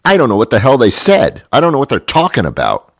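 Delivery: loud, high, fast outburst; comic exasperated rant. Real, not acted. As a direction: burst out in baffled frustration, quickly. Exasperated after mimicking the play.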